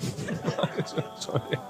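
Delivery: a quiet, airy chuckle at his own joke; soft breathy laugh. Real, not acted. Speaker chuckling to the audience after the joke, soft laugh. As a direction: laugh softly under the breath.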